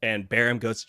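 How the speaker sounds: normal talking voice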